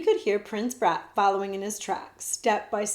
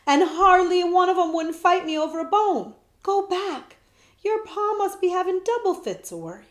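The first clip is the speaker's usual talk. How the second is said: loud, higher urging; boy insisting, raising his voice; insistent; 9 semitones higher, 6 dB louder than usual. Acted. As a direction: press him hard to go home. Jemmy urges prince home.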